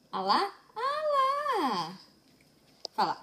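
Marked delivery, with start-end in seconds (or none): 0.7-2.0 much higher, a tiny squeal; squeaky baby voice. Pretend. Mother voicing her baby in a high squeak.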